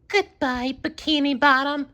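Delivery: high, cheery-sad sponge voice calling out; called out. A narrator's reading. SpongeBob calling goodbye to his town. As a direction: call a farewell to home. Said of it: "SpongeBob called out".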